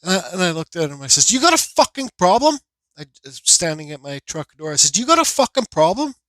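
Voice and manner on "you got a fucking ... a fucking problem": retold angry challenge. Driver confronting another at his truck, retold angry challenge. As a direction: replay squaring up to someone, pitched up.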